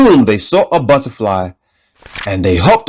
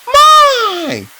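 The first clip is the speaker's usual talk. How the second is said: very high chirpy bird voice. Acted. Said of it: "said the bird".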